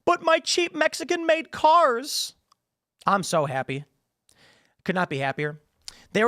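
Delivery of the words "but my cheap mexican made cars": high, slow, whiny mock wail; mock libertarian whining about tariffs, high and slow; mock crying libertarians. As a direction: whine it like a spoiled libertarian losing cheap cars. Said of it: "They're crying".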